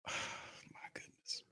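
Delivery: slow whispered sigh; hushed hammed-up despair; mock weary sigh. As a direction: sigh it out in fake grief.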